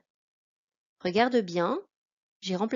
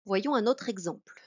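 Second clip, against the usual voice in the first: normal talking voice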